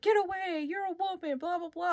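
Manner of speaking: half-hearted yell trailing into blahs; mock yell. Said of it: "yelling at her".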